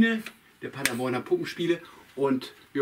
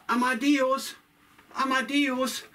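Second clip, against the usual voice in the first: much higher, long call for a lost pet; 13 semitones higher, slower than usual. Acted. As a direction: call across the square for the rooster. Hannes calling for his rooster.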